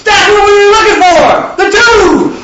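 high, loud, exultant; thrilled. Acted. Yahweh exulting to his son, high and loud. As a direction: crow it like the prize was won.

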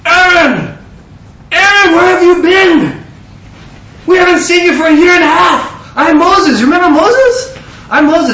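high, loud imitation of a delighted hail; excited greeting. Two Kenyans greet him after a year and a half, excited shouting. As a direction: call out as if spotting a long-lost friend.

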